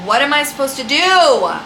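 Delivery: frustrated outburst at being ignored; exasperated. Retold or imitated. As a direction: throw up your hands at it. Annoyed, quoting own frustration.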